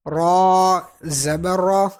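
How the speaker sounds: را slow, with a heavy full R. Shown as a demonstration. Teacher applying the zabar rule, را read full. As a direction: fill out the vowel of را, thick and rounded. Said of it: "اس کو پر پڑھیں".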